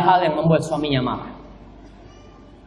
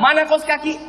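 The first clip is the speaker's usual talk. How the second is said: high-pitched mock demand; angry husband; 8 semitones higher than usual. Husband demanding socks from wife, mock angry. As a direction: imitate a cross husband without shouting. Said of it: "Suami mau marah".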